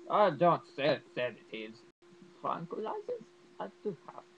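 put-on doctor voice, loud then easing off; hammed-up character voice, jumping high; bad Nazi doctor impression. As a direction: play the doctor refusing, then conceding. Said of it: "That's probably a terrible impression".

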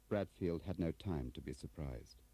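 ordinary delivery

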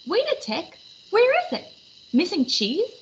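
rising alarm, pitch climbing high; suddenly find the cheese gone; startled, voice jumping up; surprised. Acted. Cheesemonger finds cheese missing.

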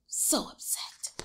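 whispered aside; softly whispered. Real, not acted. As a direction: hush the words. Aside to child.

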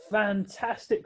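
high delighted exclamation; cried. Acted. Pelican cries out at the job, delighted. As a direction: cheer the good news. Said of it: "cried the pelican".

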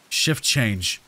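hushed, fearful warning; understated terror in a low voice; low, terrified. A narrator's reading. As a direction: say it low, with contained dread. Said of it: "he said in a low, terrified voice".

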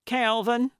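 a lifted summons to someone in another room; calling out. A narrator's reading. Gertrude calls to Calvin as she comes inside, calling out. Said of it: "she called as the screen door".

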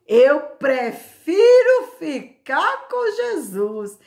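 high, loud, drawn-out declaration; playful exaggeration. Preacher repeating the presbyter's saying to listeners, playfully exaggerated. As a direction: stretch it out high with laughter in it.

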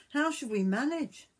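put-on high, lightly worried young man; worried son. A narrator's reading. Son asks the cat how they will live, mildly worried. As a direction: wonder aloud, a touch anxious. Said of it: "said the miller's son".

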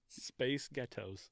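slow, trial American accent; softly stretched American vowels. Shown as a demonstration. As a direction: try it out slowly in an American accent. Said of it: "in a stereotypical American accent".

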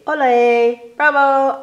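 loud high ole and bravo; loud festive cheer. Acted. Reading the Book Day poem to kids, loud festive cheer. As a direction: cheer like a crowd at a fiesta.

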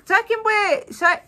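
ordinary delivery

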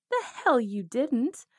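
loud, slow, indignant outburst; angry retort. Acted. Outlaw flaring up at the lover who left, loud and slow. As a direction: snap back, hurt and furious.

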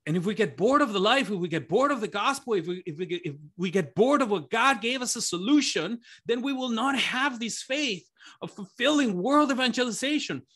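pleading, close to tears; getting emotional. Real, not acted. Preacher overcome while urging the church not to grow bored. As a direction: voice rising high and straining with feeling. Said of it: "if I get emotional".

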